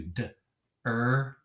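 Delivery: "D, er" as two separate sounds; a short "D", pause, then a held "er"; "D, er" phoneme by phoneme; sounding out. Shown as a demonstration. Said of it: "Sound out as you need to".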